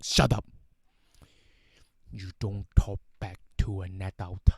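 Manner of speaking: loud scolding as an angry dad; mock-angry father shouting down his child; imitated dad yelling. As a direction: cut the kid off with a loud outburst, then lecture. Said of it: "my dad will yell at me".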